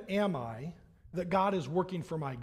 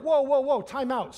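loud, higher-pitched call to halt; exclaimed interruption; 5 semitones higher, 7 dB louder than usual. Retold or imitated. Dad halting his boys' list of demands, loud objection. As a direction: cut in forcefully to stop the demands.

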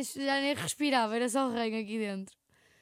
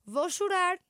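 higher, louder announcing she'll cry; mock warning; 6 semitones higher, 4 dB louder, much slower than usual. Flatmate imitates her friend's warning from bed, mock announcing.

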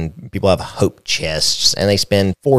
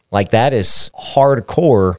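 ordinary talk

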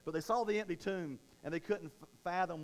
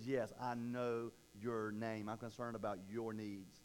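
gentle God voice; 6 semitones lower, 5 dB quieter, slower than usual. Acted. God's words voiced in sermon.

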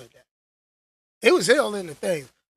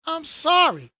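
mock apology; 9 semitones higher, 5 dB louder than usual. Real, not acted. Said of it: "mock apology".

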